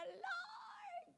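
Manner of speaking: high, soft, strained wail fading into a whisper; mock-weep a stifled plea for help; squeaky hushed cry, acted out; crying prayer. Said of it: "umiiyak ka na, sumisigaw ka na".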